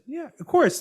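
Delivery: high, bright retold yes; a cheerful quick yes to the attorney; eager yes. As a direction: mimic your own eager agreement.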